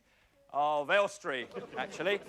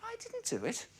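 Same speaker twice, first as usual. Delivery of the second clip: put-on whiny, much higher sulky teen; Damon Grant; 7 dB quieter than usual. Comedian mocks the Brookside teen's catchphrase, high and whiny. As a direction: squeak it out like a sulky teenager. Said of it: "all Damon Grant ever says is".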